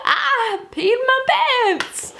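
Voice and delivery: put-on very high, squealing childish boast; gleeful mock child. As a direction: squeal it out with silly laughter, drawing out the end. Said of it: "so happy with myself".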